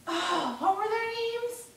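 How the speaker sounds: very high-pitched searching question; puzzled boy. Acted. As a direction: strain upward trying to remember. Boy struggles to recall friends.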